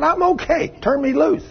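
freed woman asking the men to release her; small woman's voice. Retold or imitated. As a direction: ask to be let go meekly. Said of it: "She said".

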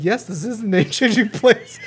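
slow, put-on office clerk voice; dad pretending to be the name-change office, slow and formal; mock official. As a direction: play the dad faking a clerk. Dad playing official on phone.

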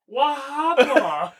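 high, slow drawn-out "What happened?" in a mock Jamaican accent. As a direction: stretch it out in a playful Jamaican accent. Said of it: "Does that sound Jamaican at all?".

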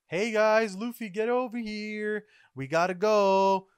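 high, urgent call to friends. Acted. Acted crewmates calling Luffy over.

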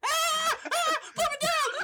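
very high, loud gnome shriek; freaking-out gnome. Acted. Gnome picked up to eye level, shrieking to be put down. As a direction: shriek in hammed-up gnome panic. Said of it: "he's freaking out".

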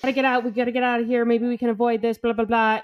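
insistent, pushing to leave; urgent escape plea. Retold or imitated. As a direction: rattle off a mock-urgent getaway plan. Acting out friends' urgent escape plan.

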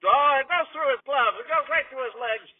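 high, loud baseball call; excited play-by-play. Acted. Announcer calling a missed catch, excited. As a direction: let the voice leap up at the fumble. Live call of fielding error.

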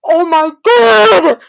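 slow, loud, high oh my God; shocked outburst. Real, not acted. Reacting to the book lying, slow shocked cry. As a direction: drag it out in disbelief.

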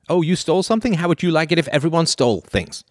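high, sing-song lecturing parent; parent scolding. Retold or imitated. Parent taking Bob's tablet, mock lecturing lilt.

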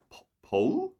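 "Paul?" with a high rising question; confused high question. Retold or imitated. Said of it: "say my name in a Canadian".

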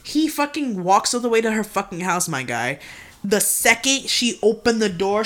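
ordinary delivery

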